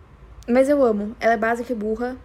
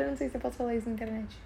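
quick, much softer aside, half to herself; hushed aside; 11 dB quieter, faster than usual. Real, not acted. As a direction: drop the voice as if confessing a secret. Confessional aside about internet. (aparte baixinho e rápido)

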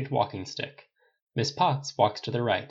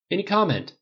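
higher-pitched prompt; raised, questioning press voice; reporter prompting; 10 semitones higher, 5 dB louder than usual. A narrator's reading. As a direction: nudge him for a statement. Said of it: "the reporter prompted".

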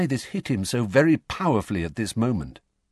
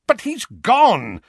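higher, louder, slow impatient insistence; 14 semitones higher, 7 dB louder, slower than usual. Acted. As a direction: brush it aside impatiently. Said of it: "said Uncle Vernon impatiently".

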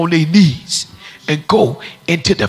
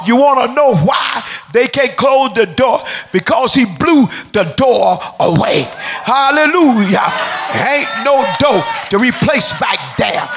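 fiery preacher crying hallelujah; preaching at full force; 6 semitones higher than usual. Real, not acted. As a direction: whip the church up.

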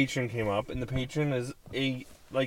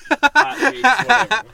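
laughing outburst; 12 semitones higher, 14 dB louder, slower than usual. Real, not acted. Mocking segment name, laughing.

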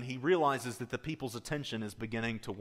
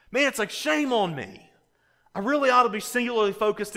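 higher and louder, pressing the point; fervent preaching; 9 semitones higher, 8 dB louder than usual. Acted. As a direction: preach it with rising fervor. Preaching at full force.

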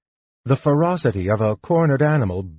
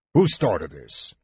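stern guardsman; 6 semitones lower, slower than usual. Acted. Guardsman demands answer from crowd.

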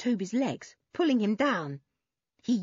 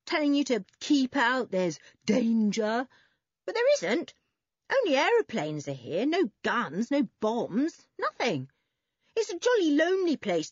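higher-pitched, breezy dismissal; airy; 5 semitones higher than usual. A narrator's reading. Boy assuring his friends there is no danger, airily. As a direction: brush the danger off lightly. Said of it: "said Toby airily".

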